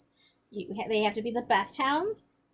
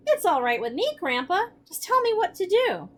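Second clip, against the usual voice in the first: blurted; 9 semitones higher, 6 dB louder than usual. Acted. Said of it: "I reared back and blurted".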